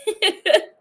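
bright burst of laughter; laughing. Real, not acted. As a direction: laugh out loud. Laughs at own recap.

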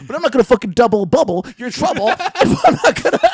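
high, loud, rapid tradesman rant. Acted. Tradesman rants at a greedy client, loud and high. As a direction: rattle off the rhyme in a loud high voice. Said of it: "That was fucking good".

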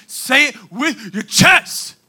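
high, loud, slow and punched; forceful exhortation. Shown as a demonstration. Preacher urging listeners to show passion, forceful exhortation. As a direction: pound out each word with conviction.

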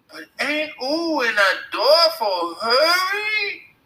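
high, slow, mock baby talk; shouted girlish taunt. A narrator's reading. Billy shouting after the boys, girlish baby-talk taunt. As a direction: whine it out like a teasing toddler. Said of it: "shouted Billy".

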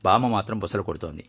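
plain reading voice

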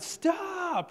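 high, loud imitated scream; screamed stop. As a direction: cry it out, stretching the vowel. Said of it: "Just starts screaming out".

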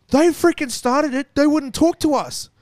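high, loud, slow protest; high-pitched mock outraged shouting; imitated indignant yelling. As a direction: yell it out like an aggrieved woman in the background. Missus yelling in the background.

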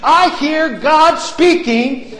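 shouting, high and loud declaration; preaching at full force. Acted. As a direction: thunder it out like a revival preacher.

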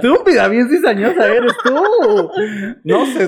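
high, laughing mock insult; playful laughing jab; laughing scold. Real, not acted. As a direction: scold her through a laugh. Teasing friend back.

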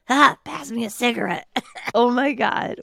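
high, laughing joke after a coughing fit. Real, not acted. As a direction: giggle through the words.

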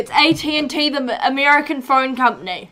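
loud and fast; loud correction. Real, not acted. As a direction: blurt out the correction loudly. Correcting a misread name.

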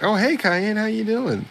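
high, chirpy cartoonish greeting; friendly guildmate. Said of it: "the other guy's like".

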